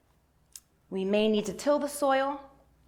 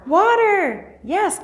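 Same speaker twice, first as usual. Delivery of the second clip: much higher, louder delighted "Water"; thrilled affirmation; excited praise; 11 semitones higher, 10 dB louder, much slower than usual. Real, not acted. Praising a child's answer.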